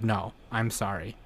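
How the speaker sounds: father soothing his sick child, a hint of softness; gentle apology. Golem apologises to the sick girl.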